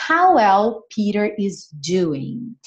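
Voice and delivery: how well Peter is doing said slowly; slow model reading. Shown as a demonstration. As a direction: read it slowly for learners. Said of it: "slow model reading".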